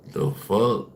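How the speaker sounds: normal talking voice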